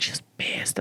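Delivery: soft whispered aside. Retold or imitated. Friend whispering to the comic, soft whisper. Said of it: "her friend was like".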